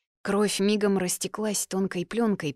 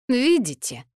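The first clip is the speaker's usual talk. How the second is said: higher, louder lecturing opener; mentor's tone; 6 semitones higher, 6 dB louder than usual. A narrator's reading. Альер lectures the others, in an obnoxious mentor's tone. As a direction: say it like an insufferable mentor. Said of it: "примерзейшим менторским тоном".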